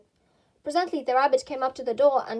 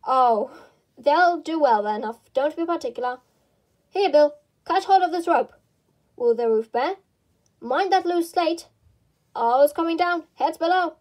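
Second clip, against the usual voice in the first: loud calls up the ladder; shouting workers; 4 semitones higher, 6 dB louder than usual. Acted. Workmen on ladders calling to Bill, loud shouting. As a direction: yell warnings across the roof.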